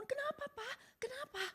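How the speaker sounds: a startled wife crying out in the dark; alarmed wife. Retold or imitated. Wife woken at dawn, asking her husband in fright.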